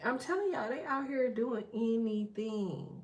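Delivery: ordinary talk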